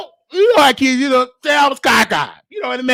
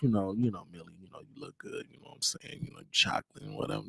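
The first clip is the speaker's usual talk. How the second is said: very low, soft sweet-talk; butter them up in a hushed deep voice; smooth-talker's put-on bass murmur; 17 dB quieter, faster than usual. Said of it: "trying to put on my D voice".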